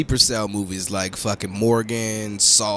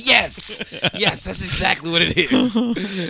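high, slow, mock-earnest voice; exaggerated high silly voice; high put-on voice; 9 semitones higher, slower than usual. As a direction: drawl it like a cartoon. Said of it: "I was trying to do that too".

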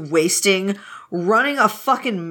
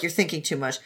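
lower, softer, flat deadpan; low curt brush-off; 6 semitones lower, 8 dB quieter than usual. Retold or imitated. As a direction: shut him down quietly and dry. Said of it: "Lan Wangji's like".

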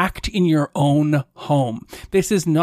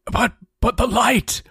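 dying paladin; 9 semitones higher than usual. Acted. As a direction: cry out toward the light as you die. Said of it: "says the paladin as he dies".

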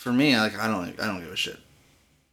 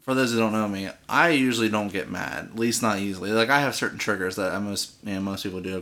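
usual speaking voice

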